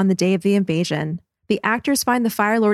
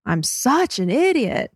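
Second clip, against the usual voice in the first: "such" stretched and pitched up; stress on "such"; 4 semitones higher, slower than usual. Shown as a demonstration. Co-host showing a clearer delivery, heavy stress on "such". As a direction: lean long and hard on "such". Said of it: "more emphasis on the word such".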